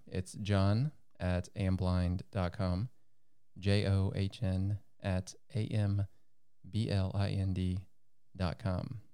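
slow, careful spelling; spelled out slowly. Shown as a demonstration. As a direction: spell each letter clearly, slowly. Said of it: "spelling out email address slowly".

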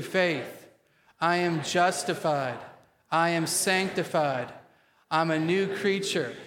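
slow, emphatic declaration with spunky attitude; strong, measured confession for a congregation to repeat; attitude of faith. Shown as a demonstration. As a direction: declare it boldly, like you believe every word. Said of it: "say it today with an attitude of faith".

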